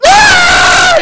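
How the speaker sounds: very high, loud mock girl scream; girlish scream. Preacher acting out his schoolboy meltdown, girlish scream. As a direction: shriek it like a girl. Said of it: "screaming like a girl".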